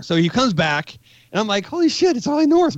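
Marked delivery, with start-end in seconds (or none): 1.7-2.8 much higher, thrilled exclamation; retold fan's giddy disbelief; starstruck. Soldier recognizing a famous correspondent, acting out starstruck excitement. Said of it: "I was starstruck".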